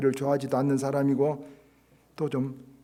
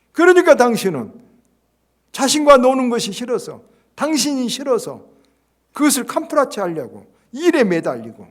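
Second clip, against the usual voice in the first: loud, much higher hammering rebuke; preached rebuke; 9 semitones higher, 11 dB louder than usual. Acted. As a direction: thunder it at him from the pulpit. Jung rebukes the pastor, preached.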